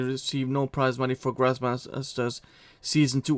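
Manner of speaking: ordinary delivery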